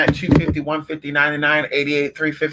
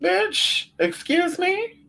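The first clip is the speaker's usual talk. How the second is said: much higher, slow, drawn-out outrage; high incredulous; 13 semitones higher, 4 dB quieter, much slower than usual. Retold or imitated. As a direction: stretch out the offended "excuse me". Acted indignant reply.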